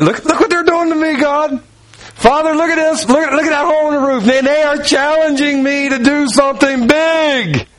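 high, eager exclaiming to the Father; excited Jesus. Retold or imitated. Jesus calling excitedly to the Father, high and eager.

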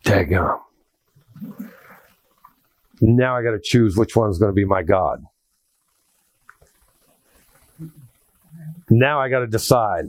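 low, deliberate retold dismay, long pauses; sobered dismay. Smoker facing a choice about serving God, sobered dismay. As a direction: let it sink in, weighing the choice. Realises smoking bars him from serving.

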